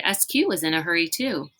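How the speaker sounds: usual speaking voice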